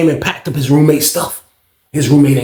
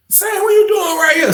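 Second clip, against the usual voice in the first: roommate's startled question. Retold or imitated. Roommate confronted, high pitched.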